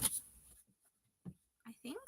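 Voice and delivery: breathy unvoiced aside; whisper. Real, not acted. Streamer muttering to herself about the setup, whispering. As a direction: whisper it to yourself.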